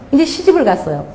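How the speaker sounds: normal talking voice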